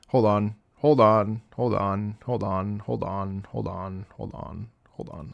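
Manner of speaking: low voice dwindling to almost nothing; fading muttered repetition. Real, not acted. Streamer stalling viewers while fixing TTS, fading low mutter.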